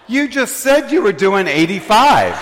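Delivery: high, loud blurted tattle; tattling friend. Retold or imitated. Friend exposes driver in front of cop, high tattling. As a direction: play the friend loudly giving it away.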